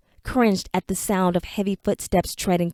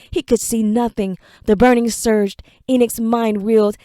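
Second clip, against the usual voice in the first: ordinary delivery, nothing marked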